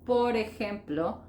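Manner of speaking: normal talking voice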